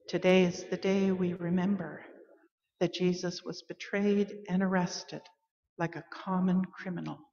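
soft, subdued, grave reading; hushed, solemn telling of a betrayal; somber remembrance. Acted. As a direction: drop into quiet sorrow and let it fade. Call to worship, sorrowful remembrance.

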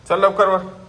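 loud, high call to the cat. Real, not acted. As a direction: call the cat in from across the terrace. Calling the cat home. (मांजरीला मोठ्याने हाक)

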